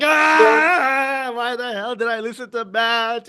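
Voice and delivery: high, hammed-up cry of regret; mock anguished wail. Trader pretending to regret listening to his adviser, mock anguished wail. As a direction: wail it in pretend agony, starting loud. Acting out future regret at advice.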